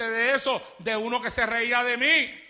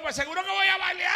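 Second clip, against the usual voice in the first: higher, louder, fast taunt with a laugh; mocking back; 9 semitones higher, 5 dB louder, faster than usual. Preacher taunts the mocker back, high, fast and laughing. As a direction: turn the laugh on him.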